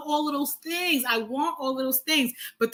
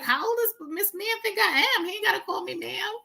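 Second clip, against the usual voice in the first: mock offended; 7 semitones higher than usual. Woman complains that a man calls her ma'am, mock offended. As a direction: act insulted, half joking. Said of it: "So I'm like".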